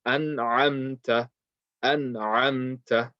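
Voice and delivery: very slow, measured "An amta", each sound held apart; said slowly. Shown as a demonstration. Teacher models practising it, said slowly. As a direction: stretch out "an amta" with a closed n. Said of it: "say it slowly".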